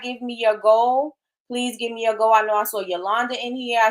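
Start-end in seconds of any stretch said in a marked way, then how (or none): none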